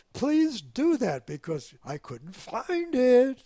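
slow pleading. Retold or imitated. Pilot pleads over radio.

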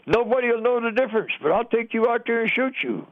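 retold scream. German officer threatening to shoot the captured sergeant, mild mock yell. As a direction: raise the pitch and rant like the furious officer, without real volume. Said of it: "he was screaming this".

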